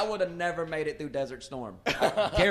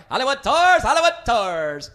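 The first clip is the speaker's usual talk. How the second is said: much higher, louder call, slowing down; hawker cry; 8 semitones higher, 11 dB louder than usual. Retold or imitated. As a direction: call out like a street tout. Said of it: "I was like".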